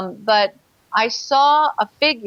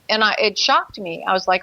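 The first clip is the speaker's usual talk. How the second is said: ordinary talk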